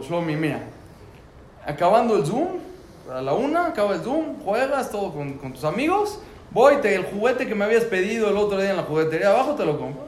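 cheerful bribing. Retold or imitated.

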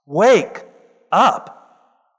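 "wake" alone, a beat, then "up"; paused stress. Acted. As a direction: split "wake" and "up" with a pause for weight. Pastor urges church to wake.

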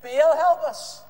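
loud, high drawn-out plea; acted cry for help to a god; calling out. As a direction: stretch it out and call high. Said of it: "they called out again".

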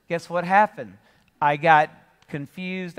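usual speaking voice

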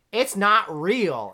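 slow with stress on not; slow emphatic. Real, not acted. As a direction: drag it out, press on not. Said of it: "we should have a talk".

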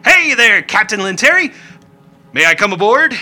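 high, loud, eager greeting; cheery little Rickety. Acted. Rickety climbs aboard and hails Captain Lanteri, high and loud. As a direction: shout up to the captain, delighted. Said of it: "and says, uh".

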